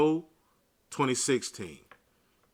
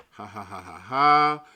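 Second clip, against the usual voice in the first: mocking laugh; 2 semitones lower, 9 dB louder than usual. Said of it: "They're laughing".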